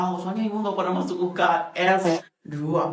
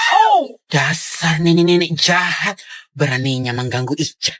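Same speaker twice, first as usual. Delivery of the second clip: dramatic slow accusation; 3 semitones lower, 6 dB louder, slower than usual. Acted. Dramatic soap opera accusation.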